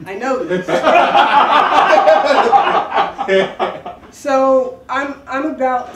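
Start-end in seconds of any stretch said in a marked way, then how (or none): 0.1-0.7 laughing. Real, not acted. Amused at psychic's accurate claim. 0.7-4.1 big loud laugh that breaks out high; loud burst of laughter. Real, not acted. Remembering the psychic who was right, bursting into loud laughter.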